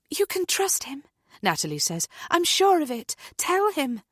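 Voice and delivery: high, firm assurance; reassuring friend. A narrator's reading. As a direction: back him up with certainty. Natalie vouches for Nick.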